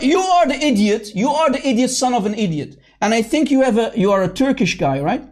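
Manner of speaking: ordinary talk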